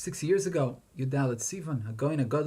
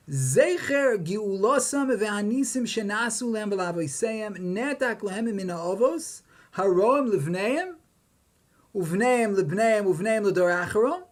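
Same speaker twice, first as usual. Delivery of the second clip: slow, raised-pitch Hebrew reading; emphatic text recitation; 5 semitones higher, 4 dB louder, slower than usual. Acted. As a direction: declaim the Hebrew source slowly, pitched up. Reads the Ramban's Hebrew aloud.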